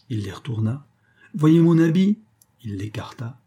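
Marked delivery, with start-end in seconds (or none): 1.3-2.2 lightly acted magician, high and loud; showman's patter. A narrator's reading. Magician has the ladies inspect his clothes, showman's patter. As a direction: show it off with a rising lift.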